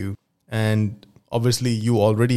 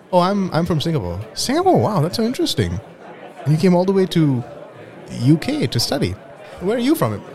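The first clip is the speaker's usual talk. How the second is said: Anna's voice; 8 semitones higher than usual. Acted. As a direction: play shy Anna, pitched up. Acted dialogue, Anna voice.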